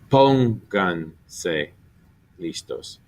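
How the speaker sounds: pónganse very slowly, stressing PÓN; stress on third-last syllable. Shown as a demonstration. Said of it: "they're gonna be three back every time".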